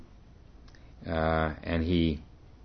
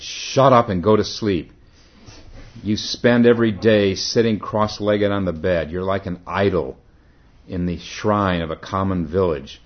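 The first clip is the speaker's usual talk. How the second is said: shouted rebuke; 2 semitones higher, 7 dB louder than usual. Acted. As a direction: bellow it at him to shut up. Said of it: "He shouted".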